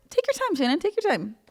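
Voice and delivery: high-pitched mock complaint; sarcastic impatience. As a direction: squeal it sarcastically at the absent texter. Mock complaint about slow reply.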